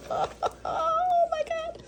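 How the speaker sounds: very high, tearful squeal; overwhelmed delight. Real, not acted. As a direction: stretch it into a tiny, slow, high whimper of joy. Overwhelmed by the cookies.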